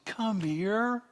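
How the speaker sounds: very high, rising, sweet coaxing call; doting grandparent beckoning in a high voice; coaxing a grandchild. Shown as a demonstration. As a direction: coax a little one over, voice lilting way up. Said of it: "Inflection is me looking at my grandchild".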